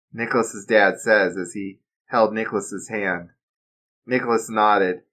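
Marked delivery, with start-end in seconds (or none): none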